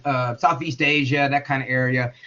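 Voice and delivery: normal talking voice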